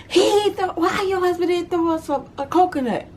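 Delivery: high teasing question; joking cousins. Retold or imitated. Cousins coming back, high and teasing. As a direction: tease about the coconut.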